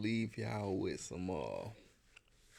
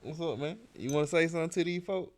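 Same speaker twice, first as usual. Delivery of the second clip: dad urging his son to speak up, higher and brighter; father coaxing his son to talk on the podcast, higher and playful; 8 semitones higher, faster than usual. Real, not acted.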